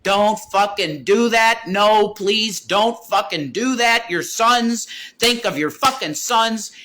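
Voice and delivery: high, loud panicked screams, slowing on the don'ts; screaming. Retold or imitated. Begging his partner not to swallow the pills, loud panicked scream. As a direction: yell at her to stop, terrified. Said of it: "And I screamed".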